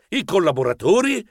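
ordinary delivery

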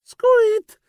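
very high sudden squeak; mouse squeak. A narrator's reading. Narrator lets out a very high startled squeak.

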